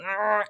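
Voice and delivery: constricted mid-throat "aah"; squeezed throat sound. Shown as a demonstration. Teacher demonstrating the ayn sound, squeezed throat. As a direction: press the throat lightly and voice it. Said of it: "গলাটা একটু চেপে ধরি".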